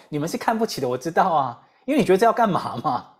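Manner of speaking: ordinary talk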